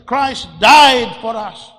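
loud and slow on died; preaching climax. Real, not acted. Preacher proclaiming Christ's death to believers, loud and slow. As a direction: lean hard into it, slowly.

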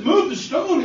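a high, ringing command; commanding Jesus. Acted. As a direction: order them to move it. Jesus commands stone moved.

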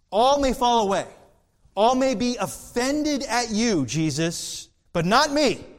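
high, loud, proud cry; Peter's proud boast. Acted. Preacher as Peter boasting to Jesus, high and loud. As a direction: fling it out like a boastful disciple.

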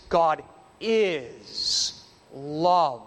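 very slow, each word of "God is love" set apart; emphatic declaration. Real, not acted. Preacher underlining what the Scriptures emphasize, slow and emphatic. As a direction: draw out "is" in "God is love" and let "love" land alone. Stresses the Scripture's key statement.

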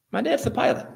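put-on a child at sea, proud his dad flies the plane; boyish excited repeat. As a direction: beam it out like a proud little boy. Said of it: "he said".

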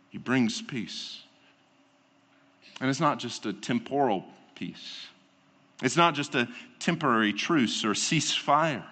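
low, soft and earnest, drawing the listeners in; hushed preaching. Acted. Preacher to his congregation about Christ's sacrifice, hushed and earnest. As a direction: speak of grace softly, close to the mic. Preacher closes on peace softly.

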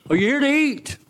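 半开玩笑地调侃; teasing question. Real, not acted. Teasing the congregation, laugh follows.

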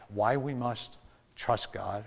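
normal talking voice

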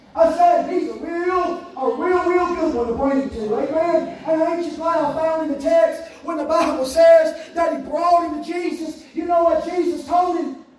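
driving, rhythmic sermon cadence; full-force preaching. Acted. Preacher recounting a father's desperate search for his boy, impassioned. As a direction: hammer each repeated phrase like a revival preacher.

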